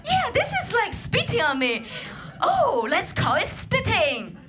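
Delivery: high, hammed-up posh British chatter; a giddy Brit reminiscing, overplayed; mock posh Brit. Comedian plays two British strangers inventing words, hammed-up posh. Acting out first British naming.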